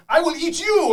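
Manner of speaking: high, loud boss yell; high screeching villain forbidding surrender; angry boss. Acted. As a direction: scream the order at the kobolds. Boss threatens kobolds.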